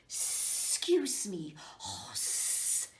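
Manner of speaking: slow hissing snake; slow hissy snake. Acted. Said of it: "said the Snake as she slid".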